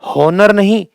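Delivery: हॉनर with an audible H, then rejected; wrong, H sounded. Shown as a demonstration. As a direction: pronounce the H in हॉनर as the error. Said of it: "नहीं होता".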